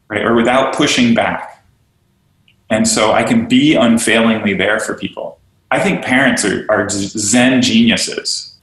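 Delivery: usual speaking voice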